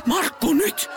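high, urgent shout; wife urging husband to act now, urgent shout. Acted. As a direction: push him into action now.

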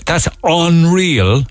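mock slow Cork "That's unreal"; drawn-out unreal. Shown as a demonstration. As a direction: stretch "That's unreal" out slowly. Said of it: "they say".

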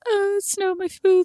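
high, drawn-out kiddie whine; whining small child. Retold or imitated. Mum imitating kids out in snow, whining. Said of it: "they come out and they're like".

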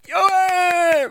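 high squealing yay; mock cheering. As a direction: squeal like an overexcited audience. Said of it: "it's like".

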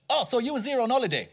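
high, laughing mock Ali G; chirpy faux-naive Ali G, laughing through it; Ali G impression. Panellist retelling an Ali G interview, laughing mock Ali G. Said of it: "glory days of Ali G".

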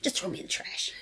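breathy whispered throwaway joke. Acted. Friends joking about funerals, whispered. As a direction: whisper it like a deadpan aside.

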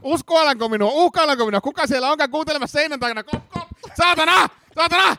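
very high-pitched hysterical suspect; epäilty, hysteerinen. Acted. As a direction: panic theatrically and cry out for a guard. Frantic suspect feels threatened. (hysteerinen, paniikissa)

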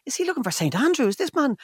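high, half-asleep fretting; high worried inner voice. Retold or imitated. Man woken by a call worrying about the caller, high mock fret. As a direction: fret aloud in a high thin voice. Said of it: "I was like".

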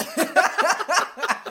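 high burst of real laughter. Real, not acted. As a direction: let a laugh break out. Laughs at his own story.

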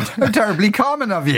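mock-scandalised grand lady, laughing; imperious plummy grande dame. Mock-scolding a cohost's cheap brand.

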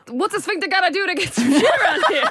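high laughing voice as the sphincter. Pretend. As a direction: play the fed-up sphincter, laughing.

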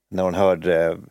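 ordinary talk, nothing marked

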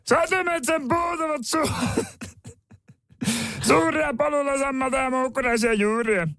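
bedtime story screeched in a very high character voice; absurdly high put-on storyteller; tepoilmies. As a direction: read the fairy tale in a sustained high, comic shout. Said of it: "teboilmies äänellä".

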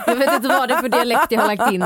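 amused laugh at a stray accent. Real, not acted. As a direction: giggle at your own slip while talking. Laughs at own odd word.

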